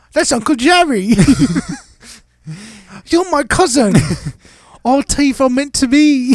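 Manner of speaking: mock British flirt.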